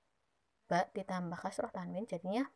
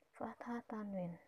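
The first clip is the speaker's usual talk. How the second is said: whisper; 9 dB quieter, slower than usual. Shown as a demonstration. Teacher repeats term in whisper.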